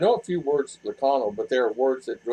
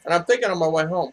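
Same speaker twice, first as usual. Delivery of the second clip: ordinary talk, nothing marked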